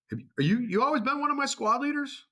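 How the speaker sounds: loud, high barked question; drill sergeant yell. Retold or imitated.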